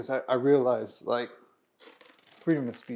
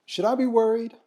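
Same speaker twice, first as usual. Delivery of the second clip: put-on higher and louder, a worried kid asking; son's worried question; 11 semitones higher, 5 dB louder than usual. Mother quoting her son asking whether he should worry, higher and louder.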